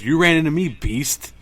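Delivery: retold taunt. Retold insult in a film scene.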